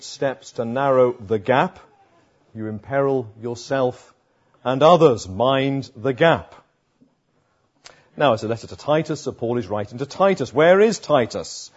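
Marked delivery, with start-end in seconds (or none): 5.3-6.5 Mind the gap said slowly, each word weighed; slow, weighty refrain. Shown as a demonstration. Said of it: "refrain of the sermon".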